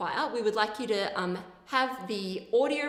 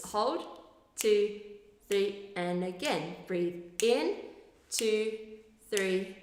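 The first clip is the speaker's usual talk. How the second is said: normal talking voice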